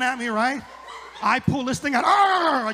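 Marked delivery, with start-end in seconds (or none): 2.0-2.7 high, loud mock growl; a hammed-up roar out the window; mock pirate roar. Comic roars at angry drivers, hammed-up growl.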